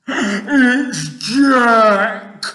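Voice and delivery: high, huge giant bellow. A narrator's reading. Giant dreaming of Jack, terrified bellow. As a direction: bellow it in terror. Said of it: "bellowed the flesh lump eater".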